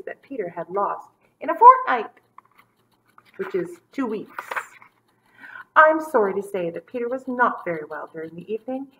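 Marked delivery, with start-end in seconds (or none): none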